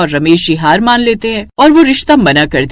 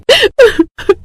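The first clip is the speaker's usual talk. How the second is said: high-pitched stage crying; acted sobbing; 13 semitones higher than usual. Bride despairing over life without garlic, sobbing. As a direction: break into acted sobs. Weeping after the apology.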